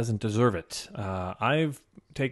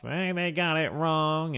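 high, drawn-out mock grumble; mock angry complaint; 6 semitones higher, slower than usual. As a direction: imitate an annoyed viewer griping. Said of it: "pissed off".

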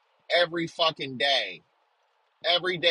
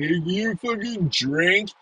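high, slow, louder mock whine; 4 semitones higher, 4 dB louder, slower than usual. Mimicking someone shocked that he drinks, high and slow. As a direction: sneer it back like a shocked prude. Mocking a kid's question.

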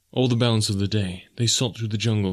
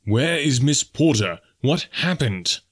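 urgent questioning; 4 semitones higher, slower than usual. A narrator's reading. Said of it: "questioned Clayton".

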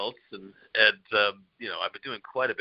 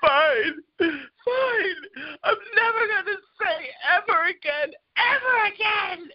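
mock child tantrum cry; slower than usual. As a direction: ham up a little girl's sobbing tantrum. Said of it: "and then she goes".

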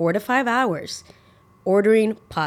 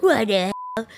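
higher-pitched mock indignant outburst; mock outrage; 4 semitones higher than usual. Imagined CEO of Olive Garden seeing the video, mock outrage. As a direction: play an affronted boss reacting.

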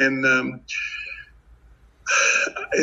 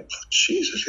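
breathy shocked gasp; 3 dB quieter than usual. Retold or imitated. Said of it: "and I'm thinking".

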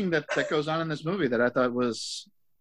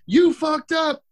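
high-pitched mock accusation; high, jeering quoted reply; mock scolding; 12 semitones higher, 4 dB louder, much slower than usual. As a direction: imitate online scolders, pitched way up. Quoting scolding Twitter replies.

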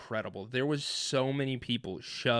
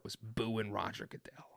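hushed aside; whisper; 10 dB quieter than usual. Real, not acted. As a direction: drop to a whisper. Whispered remark while telling story.

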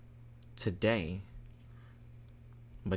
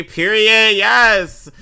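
loud, much higher cheer; excited shout; 12 semitones higher, 15 dB louder, much slower than usual. Real, not acted. Host hyping a listener's praise, loud excited cheer. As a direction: cheer it out at full volume.

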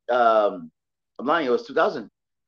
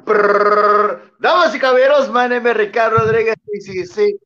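booming ring announcer intro, rolled R; lucha libre announcer; 11 semitones higher, 10 dB louder than usual. Pretend. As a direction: announce yourself to the arena crowd. Guest makes his ring entrance.

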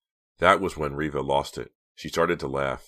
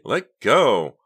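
high, loud struggling shout; alarmed, forceful demand; crying out; 9 semitones higher, 10 dB louder than usual. A narrator's reading. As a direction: yell to be released. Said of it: "she cried, trying to pull free".

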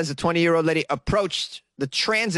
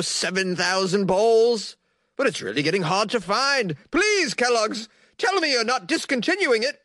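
Alan, pleading fan; 4 semitones higher than usual. Acted. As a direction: read it as a hammed-up desperate fan, building to a begging peak. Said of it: "Alan in London, Ontario wrote on Twitter".

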